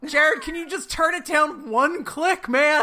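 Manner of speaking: a hint of a soaked actress begging, slow; lightly voiced weary plea to the crew; pleading actress. Actress in the rain begging Jared, mild plea. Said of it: "I'm just imagining her being like".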